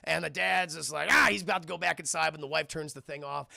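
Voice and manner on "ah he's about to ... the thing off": usual speaking voice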